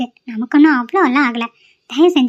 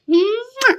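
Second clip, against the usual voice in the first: high, soft smacking kiss; kiss sound; 9 semitones higher, 4 dB quieter, much slower than usual. Acted. Wife kisses husband. (உதட்டால் முத்தம் கொடுக்கும் சத்தம்)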